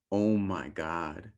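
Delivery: drawn-out dismay; mock dread. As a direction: stretch it out in despair. Partner says we need to talk.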